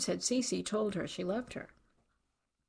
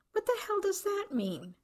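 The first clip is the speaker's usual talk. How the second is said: shocked; 12 semitones higher than usual. A narrator's reading. Horrified mother recoils.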